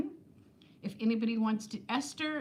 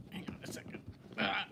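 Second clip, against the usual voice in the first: whispered aside; 8 dB quieter, faster than usual. Real, not acted. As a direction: whisper it off-mic. Aside while fumbling a box.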